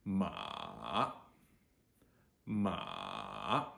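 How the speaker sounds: MA dropping to a low creak, then rising; dipping third tone. Shown as a demonstration. Teacher models third tone, MA dipping low then rising. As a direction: sink MA to the bottom, then pick it up. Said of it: "goes basically as low as your voice can go".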